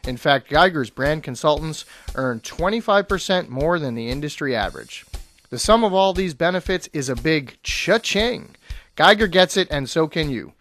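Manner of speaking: upbeat radio ad read. Acted. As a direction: sell it like a commercial. Reads a sponsor ad.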